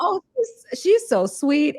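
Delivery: high, gushing aside; fond thought. Retold or imitated. Host recalling her first impression of the singer, high and fond. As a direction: coo it fondly. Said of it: "I'm thinking".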